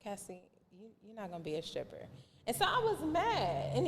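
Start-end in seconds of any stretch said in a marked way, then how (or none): 0.0-2.1 dad's quiet firm no. Retold or imitated. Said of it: "he was like".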